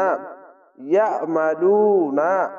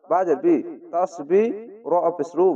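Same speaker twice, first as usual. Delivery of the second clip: ordinary talk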